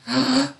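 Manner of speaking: high theatrical gasp; mock gasp. Host gasping at players over the question type, hammed-up. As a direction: gasp in pretend shock.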